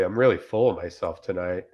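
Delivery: normal talking voice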